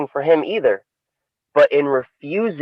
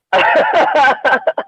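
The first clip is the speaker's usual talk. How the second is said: high laugh; 14 semitones higher than usual. Real, not acted. Teller laughs after parable.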